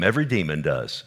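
normal talking voice

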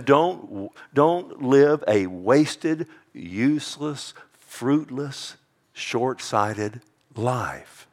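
each word drawn out very slowly; very slow, weighty admonition; slow emphatic warning. Real, not acted.

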